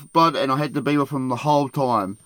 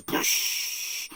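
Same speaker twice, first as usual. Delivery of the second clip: mock splash; 6 dB quieter than usual. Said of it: "it goes".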